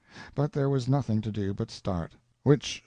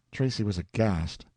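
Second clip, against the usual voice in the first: ordinary delivery, nothing marked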